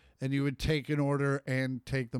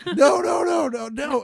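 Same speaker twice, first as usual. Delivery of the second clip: laughing shout; 11 semitones higher, 8 dB louder than usual. Real, not acted. Laughing protest over the bit.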